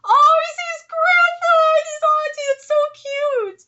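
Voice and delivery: high, loud squeal of delight, slowed; gushing squeal. Real, not acted. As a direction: squeal over how cute it is. Fan gushing over cute manga panel.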